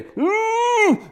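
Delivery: high strained grunt of rage; a tight furious hum; crowd's enraged high grunt; mock angry grunt. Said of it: "And they".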